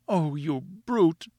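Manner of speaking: high, slow, drawn-out lament; anguished reproach. A narrator's reading. Wife stuck on pedestal, anguished reproach. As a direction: moan it high and slowly.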